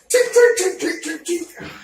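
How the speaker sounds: mimicking light hammering; hammer tapping sound. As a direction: tap it out like a small hammer.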